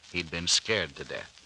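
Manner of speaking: ordinary talk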